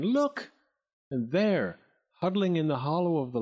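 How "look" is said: very high, urgent exclamation; high insistent call. A narrator's reading. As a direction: press them to see it. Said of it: "insisted Clack, pointing again".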